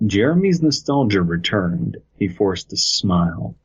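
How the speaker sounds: ordinary delivery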